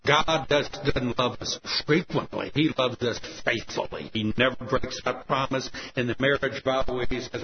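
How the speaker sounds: normal talking voice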